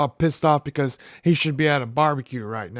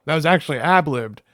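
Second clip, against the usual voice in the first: ordinary talk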